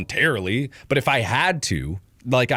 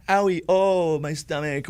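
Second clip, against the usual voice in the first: whiny patient; 5 semitones higher than usual. Retold or imitated. Minor patient behind curtain, mock whine fading off. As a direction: moan feebly in mockery.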